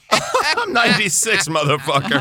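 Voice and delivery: laughing while quoting; chuckling through the quote; laughing imitation. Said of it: "That's a good name for your podcast".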